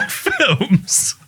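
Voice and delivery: high, breaking into laughter on the word; laughing. Real, not acted. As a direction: lose it laughing as you say it. Breaks up laughing mid-sentence.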